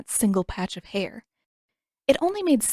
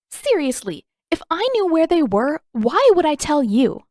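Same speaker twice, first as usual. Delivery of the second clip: higher, louder, scornful disbelief; exasperated anger; 8 semitones higher, 6 dB louder than usual. A narrator's reading. Katara cutting off the prince's question, exasperated loud. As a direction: cut him off, exasperated.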